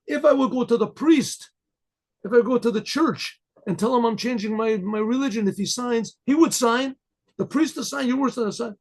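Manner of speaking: high-pitched, pressing protest; desperate pleading. Retold or imitated. Man in pain begging the rabbi to sign, desperate.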